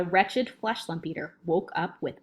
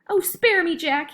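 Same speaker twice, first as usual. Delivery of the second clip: high, loud cry for mercy; pleading yell; 10 semitones higher, 5 dB louder than usual. A narrator's reading. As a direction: beg for your life. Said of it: "he yelled".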